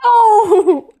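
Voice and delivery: high, loud wail; loud cry with laughter in it; dismayed yell. Real, not acted. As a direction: wail as the game goes wrong.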